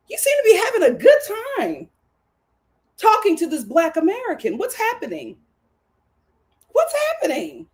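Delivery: high, emphatic mock questioning; incredulous reaction. Host reacting to Taylor flirting with Coco, incredulous. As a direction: play up the disbelief, pitch jumping high.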